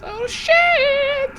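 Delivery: very high-pitched exclamation; real alarmed squeak; dismayed. Real, not acted. Player dreads a surprise roll.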